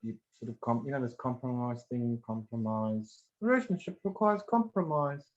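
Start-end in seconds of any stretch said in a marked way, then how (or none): 3.4-5.2 higher-pitched parroting of a saying; mock received wisdom. Therapist quoting a belief to knock it down, mock-parroting. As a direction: recite the rule you are about to reject. Voices a common belief to rebut it.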